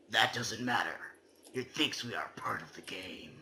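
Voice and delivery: hushed, secretive whisper; spooky whisper. Acted. Reading the game's ending poem aloud, in a whisper. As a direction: breathe it like a secret.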